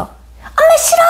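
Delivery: put-on very high, drawn-out child's protest; bratty child. Child fighting with mom, high bratty shriek. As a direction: whine it out like a fighting kid. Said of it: "아이랑 싸우는 엄마도 봤어".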